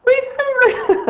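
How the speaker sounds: very high, slow, laughing through the words; giggling falsetto. Pretend. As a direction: giggle it out in a silly squeak. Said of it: "I'm such a dork".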